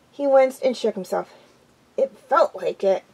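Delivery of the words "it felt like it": ordinary delivery, nothing marked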